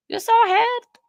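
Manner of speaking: high, loud surprised question; shocked you saw a head. Real, not acted. Chat reacts to spotting someone.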